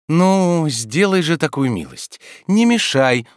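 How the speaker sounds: high, emphatic coaxing; earnest pleading. A narrator's reading. Master begging servant not to disturb him, pleading earnestly. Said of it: "убедительно говорил Обломов".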